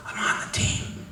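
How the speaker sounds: hushed secret whisper. Retold or imitated. Colleagues tapping his shoulder, whispered. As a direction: drop to a breathy secret. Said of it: "Why are you whispering?".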